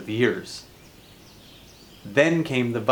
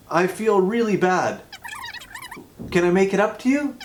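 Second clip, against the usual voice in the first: higher, gushing apology, piling it on; mock grovelling sorry, a hint pitched up; over-apologetic Canadian; 6 semitones higher than usual.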